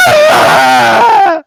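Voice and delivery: loud, high-pitched scream; full-volume high yelp of shock; startled shout. Acted. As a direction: shriek at being caught unawares. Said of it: "they're both kinda shouting".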